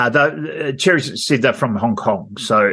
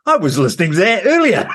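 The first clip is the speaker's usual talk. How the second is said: higher, louder and quick; retold excitement, quick and high; excited; 7 semitones higher, 3 dB louder, faster than usual. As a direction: blurt it out delighted. Excited retold remark, laughing.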